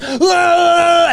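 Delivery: high loud mock yell; mock shout. Mocking the rowdy crowd with a high loud yell. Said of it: "And then there's".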